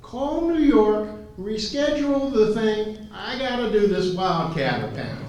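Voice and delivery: imitated yell to the next room; high, drawn-out call to an assistant; Mike. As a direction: holler orders through the wall, mimicking the boss. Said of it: "he'd rather yell to Mary".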